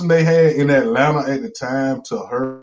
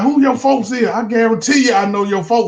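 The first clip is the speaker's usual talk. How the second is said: high, eager question; excited young woman; 8 semitones higher than usual. Retold or imitated. Girl asking the comedian about his family, high eager question. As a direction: pitch it up with quick curiosity. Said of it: "She was like".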